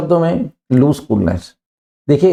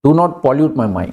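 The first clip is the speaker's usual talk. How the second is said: each word spaced out slowly; slow model sentence; much slower than usual. Shown as a demonstration. Teacher to students, modelling the phrase slowly. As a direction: say it slowly so students can repeat. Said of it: "यहाँ से जाइए".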